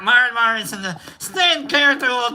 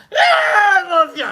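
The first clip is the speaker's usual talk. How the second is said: high, loud mock squeal of affection; hammed-up cry of love; imitated gushing shout; 8 semitones higher, 5 dB louder than usual. Friend greeting with a big hug, high imitated shout.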